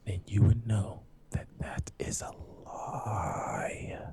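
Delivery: low, drawn-out close-mic delivery; slow and deliberate. Shown as a demonstration. Podcaster explaining how WWE works, slow and deliberate. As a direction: sink into a stretched near-whisper on the last word. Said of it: "I just wanna say this slowly".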